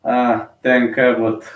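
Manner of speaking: ordinary talk, nothing marked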